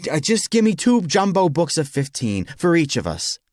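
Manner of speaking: sigh, then force it out through clenched teeth; exasperated, barely contained irritation; through gritted teeth. Acted. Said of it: "He grit his teeth and said".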